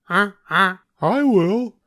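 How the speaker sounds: keen to eat now; goose. A narrator's reading. Goose eagerly volunteering to eat, high voice. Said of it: "said the goose".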